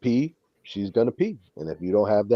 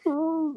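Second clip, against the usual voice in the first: a high yappy bark, pitched way up; mock bark. Mimicking his poodle barking at every noise. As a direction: yap it out like a tiny poodle.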